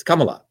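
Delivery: corrected "Kamala", opening like "come"; Tamil pronunciation. Shown as a demonstration. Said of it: "with the Tamil Indian accent".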